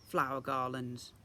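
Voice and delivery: ordinary delivery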